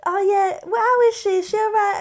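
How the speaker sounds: high, loud Cockney mum asking after her; mum's Cockney. Retold or imitated. Son imitating his mum asking the producer about herself, high loud Cockney. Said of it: "it's proper Cockney".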